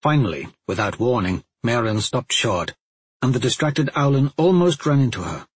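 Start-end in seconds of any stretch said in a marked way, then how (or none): none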